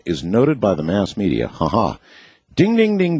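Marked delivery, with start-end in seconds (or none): 2.5-3.2 excited ding. Real, not acted. Announcing a correct prediction.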